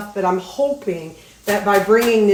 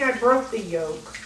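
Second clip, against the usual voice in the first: ordinary delivery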